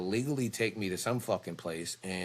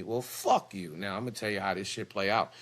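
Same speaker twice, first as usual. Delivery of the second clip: ordinary talk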